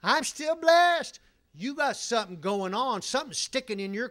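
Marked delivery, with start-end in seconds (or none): 0.0-1.2 high, loud, drawn-out insistence; mock defiant piety. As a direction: imitate someone stubbornly proclaiming their blessing. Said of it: "when the thorns of life come in around you".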